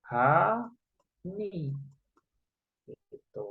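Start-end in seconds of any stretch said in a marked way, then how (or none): 0.1-1.7 "H, ni" as ha, long pause, ni; sounded H. Shown as a demonstration. Teacher shows front H can be pronounced, sounded H. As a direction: sound the H as "ha", then "ni" apart. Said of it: "dibunyikan dengan kata H".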